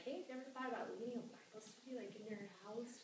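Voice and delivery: breezy fast ask; casual sneaky pitch. Retold or imitated. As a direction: slip the question in lightly. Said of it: "spring the question of".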